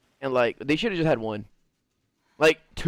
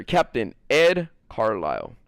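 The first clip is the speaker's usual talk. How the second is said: ordinary delivery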